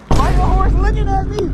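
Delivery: high, loud startled complaint; alarmed yell. Real, not acted. Rider complains about the horse looking at him, high and loud.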